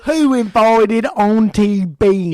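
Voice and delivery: loud, slow, drawn-out call; mock grand announcement. Mocking the family greeting the beans aunt, loud slow. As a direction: announce it like a party host, slowly.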